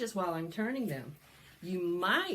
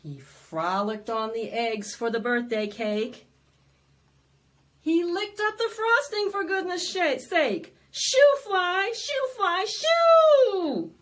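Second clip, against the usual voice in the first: louder, bouncy rhyme reading; lively read-aloud; 6 semitones higher, 11 dB louder than usual. Acted. Librarian reading the fly book to children, loud and bouncy. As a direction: perform the book for a crowd of kids.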